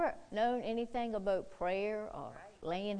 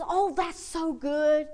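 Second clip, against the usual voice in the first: slow, pitched-up little grandma delight; 7 semitones higher, 6 dB louder, slower than usual. Retold or imitated. Grandma delighted at the prayer, slow and high. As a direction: savour it like a thrilled old lady. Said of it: "She goes".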